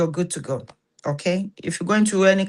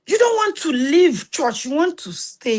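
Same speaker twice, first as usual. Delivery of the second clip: excited; 8 semitones higher than usual. Real, not acted. Passionate about staying at church.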